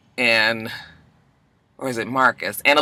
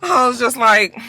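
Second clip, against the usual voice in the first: usual speaking voice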